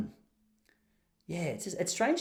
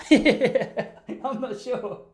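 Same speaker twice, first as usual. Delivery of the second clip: slow, drawn-out laugh, much higher; 11 semitones higher, 6 dB louder, much slower than usual. Real, not acted. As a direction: laugh it off, stretched out. Laughs about losing the check.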